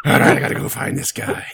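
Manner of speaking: breathy loud put-on voice; acted guy voice. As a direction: voice the guy, half-laughing. Acting out the guy's line.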